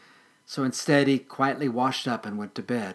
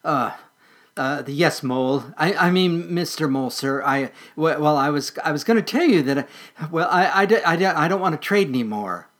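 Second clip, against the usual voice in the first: stammering in fear; 4 semitones higher, 6 dB louder than usual. Acted. Terrified squirrel stammering.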